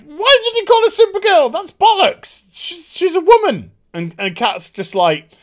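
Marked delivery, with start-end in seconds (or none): 0.0-3.7 much higher and louder indignant mock. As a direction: shriek it like an outraged prig. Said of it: "so she's like".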